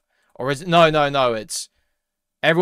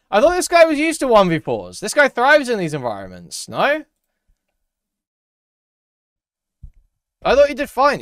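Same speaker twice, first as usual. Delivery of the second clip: squeaky high, loud mocking voice; mock them, pitch way up and sneer; sarcastic high mock; 10 semitones higher, 6 dB louder than usual.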